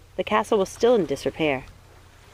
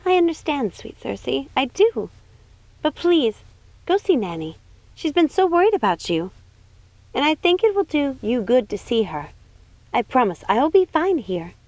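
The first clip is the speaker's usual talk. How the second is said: a higher, bright-eyed princess voice; 7 semitones higher than usual. Acted.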